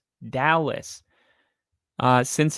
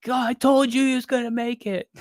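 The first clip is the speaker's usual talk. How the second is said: much higher, cartoonish know-it-all voice; mocking himself; 10 semitones higher than usual. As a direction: squeak it out like a smug superfan. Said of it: "And I'm like".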